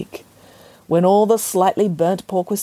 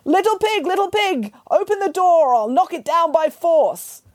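yelling butcher; 12 semitones higher than usual. Acted. Butcher yelling at the pig's straw door. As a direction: bellow it at the door. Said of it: "so he had to yell".

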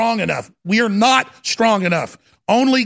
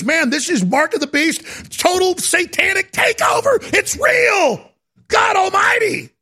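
real angry shouting; 11 semitones higher than usual. Real, not acted. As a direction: rage at the top of your voice. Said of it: "it pisses me off".

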